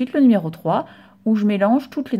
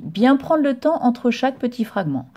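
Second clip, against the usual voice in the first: ordinary talk, nothing marked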